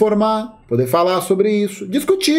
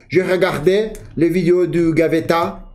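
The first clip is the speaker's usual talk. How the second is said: French with a light French intonation; speaking French; slower than usual. Shown as a demonstration. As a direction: slip into French tune. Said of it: "parece que a nossa entonação muda".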